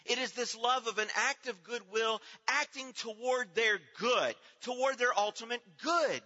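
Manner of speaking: high, loud rising sermon voice; fervent preaching. Acted. Preacher exhorting grieving mothers in church, fervent preaching. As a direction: lift the congregation with conviction.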